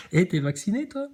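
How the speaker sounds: normal talking voice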